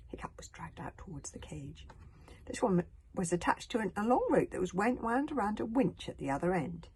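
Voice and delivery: ordinary delivery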